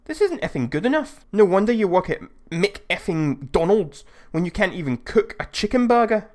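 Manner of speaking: put-on high-pitched Scouse accent, indignant and sneering; a hint of a ranting Liverpool lad. Angry customer insulting the cashier, high Scouse rant. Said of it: "This sentence in Scouse was hilarious".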